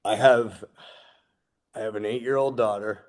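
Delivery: real emotion welling up, voice held in; getting emotional. Real, not acted. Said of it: "I'm getting emotional".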